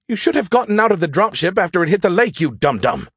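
high, fast exasperated rant; exasperated scolding. Acted. AI scolding pilot trapped in sunken dropship, exasperated. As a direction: scold him in a rush, then drop on the insult.

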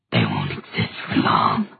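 deep, far-off demon hiss. Acted. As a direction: hiss it like a monster from below. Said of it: "hissed the Dantalian demon".

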